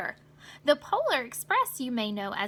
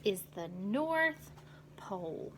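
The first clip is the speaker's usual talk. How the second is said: low, slow, hushed announcement; slow awed conductor; conductor announcing; 4 semitones lower, 7 dB quieter than usual. Acted. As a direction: reveal the North Pole in awe. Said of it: "said the conductor".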